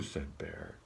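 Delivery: ordinary delivery, nothing marked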